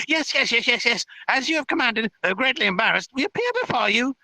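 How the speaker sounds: high-pitched flustered fairy voice; great panic. Acted. Fairies answering the threat to come out, in great panic. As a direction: blurt it out in a squeaky panic. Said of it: "in great panic".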